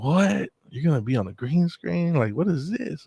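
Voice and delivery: high-pitched incredulous questions; retold amazement. Coworkers hearing about the green screen video, amazed. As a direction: jump up high in disbelief.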